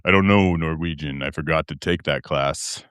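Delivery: very low host voice; low, put-on late-night delivery; put-on deep voice. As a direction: drop deep and hold the voice. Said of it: "I already broke the voice".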